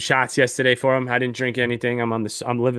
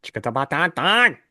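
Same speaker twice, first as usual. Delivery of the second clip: mock gibberish; 4 semitones higher, 3 dB louder than usual.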